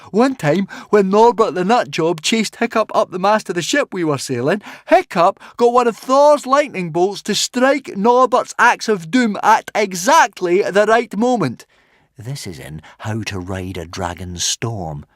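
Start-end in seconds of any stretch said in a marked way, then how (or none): 0.1-11.6 high, loud, insistent account; hot arguing. A narrator's reading. Fishlegs proves Hiccup harnessed thunder, arguing hotly. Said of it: "argued Fishlegs hotly".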